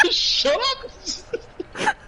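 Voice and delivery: very high squeal through laughter; laughing protest. Real, not acted. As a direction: squeal it helplessly while cracking up. Laughing protest at friend's teasing.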